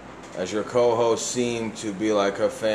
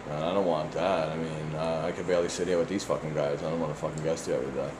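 quick, lower, softer muttered complaint; grumbling aside; 5 semitones lower, 8 dB quieter, faster than usual. Real, not acted. Grumbling to listeners about the co-hosts, fast, low and soft. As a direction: grumble it under your breath, fast.

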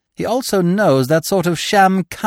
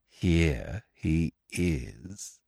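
hushed gloat; 5 dB quieter, much slower than usual. Acted. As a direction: breathe it out with cruel delight. Whispered final gloat.

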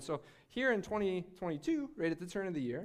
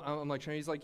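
normal talking voice

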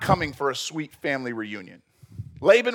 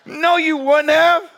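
scoffing retort; 13 semitones higher, 9 dB louder, slower than usual. Real, not acted. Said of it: "mocking Laban's promise".

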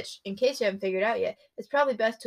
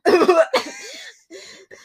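loud burst of laughter; 10 semitones higher, 9 dB louder than usual. Real, not acted.

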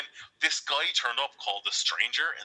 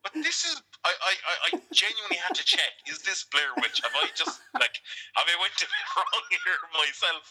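squeaky high, baffled and laughing; mock-baffled, cracking up; high disbelief; 6 semitones higher than usual. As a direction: voice shoots way up in disbelief. Retelling own disbelief, high.

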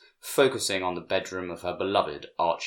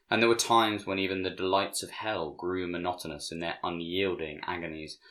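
ordinary delivery, nothing marked

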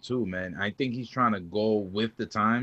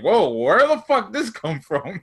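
mock shocked; 9 semitones higher, 7 dB louder, slower than usual. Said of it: "I was like".

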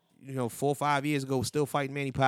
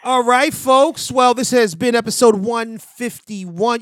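high, loud, drawn-out announcement; loud sign-off; 11 semitones higher, 11 dB louder, much slower than usual. Real, not acted. Podcast host closing the episode to listeners, loud announcer call. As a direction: ring out the episode number.

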